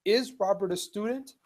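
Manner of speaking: rising question again, pitch up on "Is"; question tune. Shown as a demonstration. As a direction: rise at the end of "student?". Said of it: "it becomes a question".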